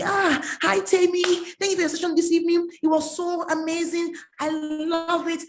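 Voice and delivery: mock the overeager young fan; enthusiastic gush. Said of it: "enthusiastically was in my inbox".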